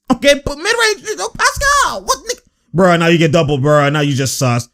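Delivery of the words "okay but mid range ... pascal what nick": very high, strained reaction to the play; exasperated squeal. Real, not acted. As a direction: squeal it in disbelief.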